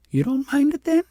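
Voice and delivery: put-on a child wanting to be sure; child repeating her question to princess; questioning little girl. A narrator's reading.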